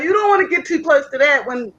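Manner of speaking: ordinary talk, nothing marked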